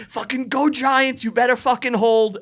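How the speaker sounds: high, urgent sports-fan yell; excited cheer. Retold or imitated. Fan yelling at the game as the lead changes, excited cheer. Cheering as numbers about to hit.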